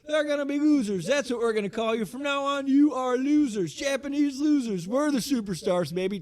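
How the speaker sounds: high, loud wrestling manager taunt; Bill Alfonso. Retold or imitated. Manager taunting the challengers as losers, hammed-up impression. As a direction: crow it in a very high, pushed voice. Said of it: "Bill Alfonso gets back on the mic".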